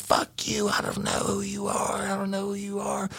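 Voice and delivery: high, whispery imitation of the old woman; old lady's retort. Cop retelling the armed woman refusing him. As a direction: hiss it like a squeaky old lady. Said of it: "She said".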